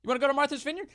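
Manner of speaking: high, mock-welcoming official; hammed-up cheerful host; mock governor voice. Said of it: "these Republican governors were like".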